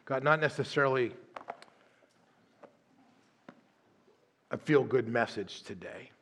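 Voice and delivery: low, soft, unhurried prayer voice; closing prayer. Acted. Pastor closes the sermon praying over the congregation, hushed and low. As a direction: pray it quietly, with long pauses.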